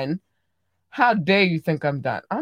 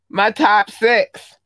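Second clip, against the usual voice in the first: louder, higher, drawn-out shout-out; emphatic announcement; 5 semitones higher, 7 dB louder, much slower than usual. Real, not acted. Podcaster presenting favorite listener countries, loud proud announcement. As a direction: announce it big and slow.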